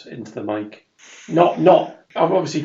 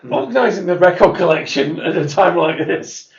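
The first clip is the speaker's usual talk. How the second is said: mock guard voice; 5 semitones higher, 6 dB louder than usual. Voicing the guard stacking records.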